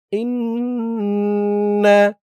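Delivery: ইন্না with a long nasal hum on the ন্ন; nasal ghunna. Shown as a demonstration. Teacher demonstrates wajib ghunna, long nasal ইন্না. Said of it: "ওয়াজিব গুন্না".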